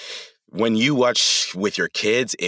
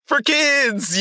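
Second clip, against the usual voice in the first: high-pitched put-on kids' line; mock kiddie-show cheer. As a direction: sing it out high, mocking the phrase. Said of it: "we'd all freeze and be like".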